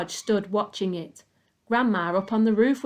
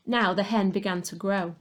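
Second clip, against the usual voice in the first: plain reading voice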